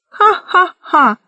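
high, slow spelt-out "ha-ha-ha"; villainous cackle. A narrator's reading. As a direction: gloat with a slow acted laugh. Villain gloats over crushing Alice.

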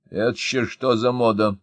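ordinary delivery, nothing marked

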